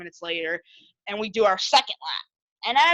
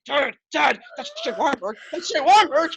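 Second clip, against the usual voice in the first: urgent nudge; 4 semitones higher than usual. Retold or imitated. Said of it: "I was, like, nudging my dad".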